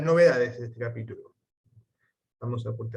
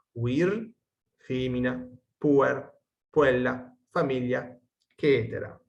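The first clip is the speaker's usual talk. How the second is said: measured, careful textbook reading; Latin text read aloud. Acted. Teacher reading the Latin lesson aloud, measured.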